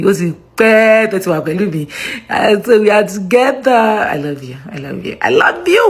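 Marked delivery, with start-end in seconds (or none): 4.1-5.2 low, soft endearment; soft affection. Real, not acted. Signing off to her viewers, quiet low affection. 5.2-5.9 gushing affection. Real, not acted. Signing off to her viewers, high gushing affection. Affectionate sign-off, bigger repeat.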